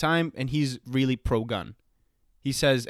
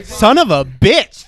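slow, drawn-out yell, much louder and higher; loud exclamation; 6 semitones higher, 14 dB louder, much slower than usual. Acted. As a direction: blurt it out at full volume. Outburst during the debate.

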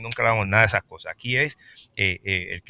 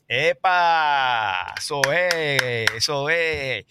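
a bright, raised whoop of approval, slowing on the praise; excited cheer; 4 semitones higher, 5 dB louder, much slower than usual. Real, not acted. Host cheering the guest's song, excited whoop. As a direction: cheer the singer on, higher and louder.

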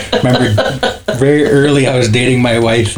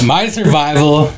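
usual speaking voice

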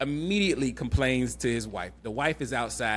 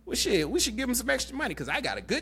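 much higher, surprised husband voice; impressed husband; 10 semitones higher than usual. Retold or imitated. Husband admiring the cut grass, lightly voiced raised surprise. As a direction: act pleasantly shocked at the lawn in a raised voice. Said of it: "She's like".

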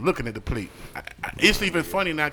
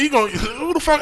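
mock shock; 11 semitones higher, 4 dB louder than usual. Shocked reaction retold.

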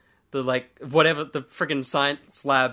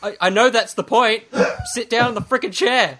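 exasperated; 6 semitones higher, 4 dB louder than usual. Retold or imitated. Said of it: "I'm like".

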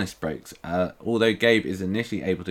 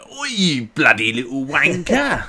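loud, overdone Cockney shout; hammed-up Cockney yell at a villain; Cockney accent; 7 semitones higher, 7 dB louder than usual. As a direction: bark it in a thick mock Cockney accent. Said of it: "the overly pronounced, like, Cockney accent".

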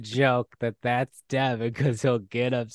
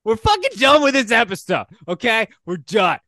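yelling; 13 semitones higher, 11 dB louder than usual. Acted. Mock angry outburst ending episode.

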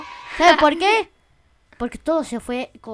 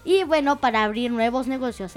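normal talking voice

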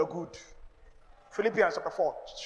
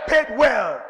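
louder, shout the words at the congregation; shouted call; 3 semitones higher, 9 dB louder than usual. Real, not acted. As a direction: call the crowd to echo it back loud. Calls congregation to repeat.

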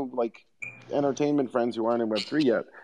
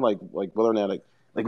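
ordinary talk

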